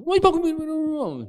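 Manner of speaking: high, loud griping; neighbour complaining. Retold or imitated. Neighbour griping at the pastor, high and loud. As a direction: gripe at him in a rush.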